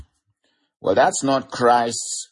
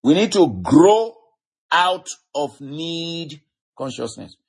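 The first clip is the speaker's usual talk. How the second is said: slow, raised-pitch preacher's emphasis; deliberate, drawn-out exhortation; 7 semitones higher than usual. Real, not acted. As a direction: stretch each key word so it sinks in. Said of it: "I'm saying it slowly".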